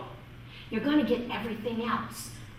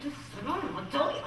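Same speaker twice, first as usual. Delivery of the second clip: fast, higher muttered inner outburst; inner exasperation; 4 semitones higher, 5 dB quieter, faster than usual. Retold or imitated. Teacher's hidden reaction to a pestering pupil, exasperated. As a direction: imitate an exasperated teacher's silent thought. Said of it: "inside I'd go".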